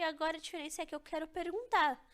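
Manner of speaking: ordinary talk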